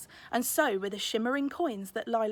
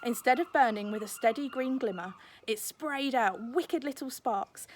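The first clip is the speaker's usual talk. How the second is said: plain reading voice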